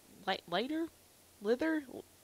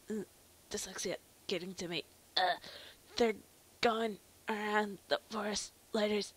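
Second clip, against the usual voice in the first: usual speaking voice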